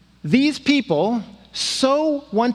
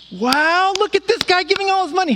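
high, fast awed gasp; amazed crowd; 10 semitones higher, faster than usual. Retold or imitated. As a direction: gush like an impressed onlooker. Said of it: "And they're like".

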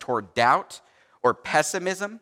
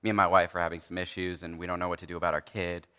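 low, soft, worn-out mock sigh of a tired colleague; exhausted; 8 semitones lower, 6 dB quieter than usual. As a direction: play him as drained and spent. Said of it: "He's like".

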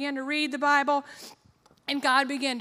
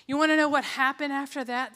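normal talking voice

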